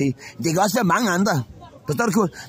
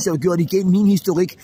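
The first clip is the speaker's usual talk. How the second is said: normal talking voice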